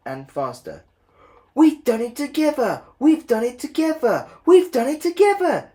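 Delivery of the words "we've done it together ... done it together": very high, loud jubilant cry; triumphant. A narrator's reading. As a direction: celebrate the win. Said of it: "said Edward and Henry".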